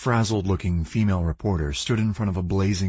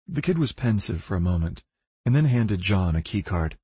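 ordinary delivery

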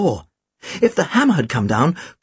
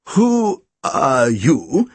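very slow, wavering question; faltering, drawn-out challenge; quavering; 3 semitones lower, much slower than usual. A narrator's reading. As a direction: quaver it out, stretching every word. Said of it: "he quavered".

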